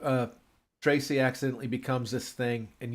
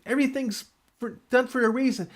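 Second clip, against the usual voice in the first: zealous car; 9 semitones higher than usual. Retold or imitated. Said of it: "she's like".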